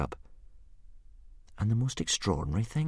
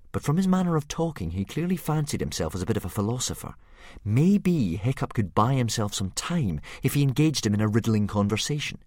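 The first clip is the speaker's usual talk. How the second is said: ordinary delivery, nothing marked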